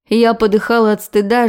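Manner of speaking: plain reading voice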